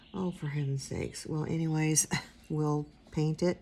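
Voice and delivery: usual speaking voice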